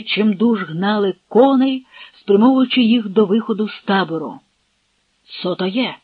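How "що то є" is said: ordinary delivery, nothing marked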